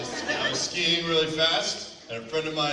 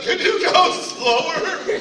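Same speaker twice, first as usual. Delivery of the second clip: high, loud, drawn-out wail; slow, pitched-up retold yell; pained plea; 9 dB louder, much slower than usual. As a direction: beg in mock agony, stretching the words. Retells plea to ski patrol.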